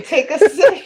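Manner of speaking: high, loud quote broken by laughter; laughing mock quote. Daughter teasing mom about the sip, laughing loudly. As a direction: repeat it teasingly through a laugh.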